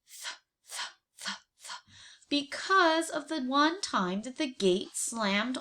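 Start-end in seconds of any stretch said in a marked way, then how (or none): 0.1-1.8 soft unvoiced th sounds; whispered th. Shown as a demonstration. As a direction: breathe th th almost silently. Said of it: "what do they say?".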